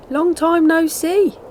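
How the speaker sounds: high and loud hello; cheery hare greeting. A narrator's reading. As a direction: hail an old friend.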